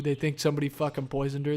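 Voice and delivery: ordinary delivery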